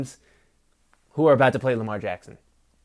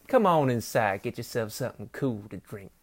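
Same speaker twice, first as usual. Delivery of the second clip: Southern belle trailing down softer and lower; 3 semitones lower, 5 dB quieter than usual. Acted. Hostess inviting a guest in for a drink, Southern belle. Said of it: "the deal with the Southern Belle".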